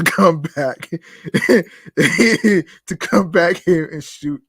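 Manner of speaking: words breaking into high, loud laughter; laughing while reading. Real, not acted. Host reading Affleck's quote aloud, laughing through it. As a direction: read on through a real giggle.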